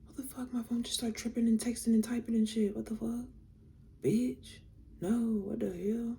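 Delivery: high-pitched, baffled alarm; startled disbelief. Real, not acted. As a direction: react as if your phone has gone haywire. Startled by her phone acting up.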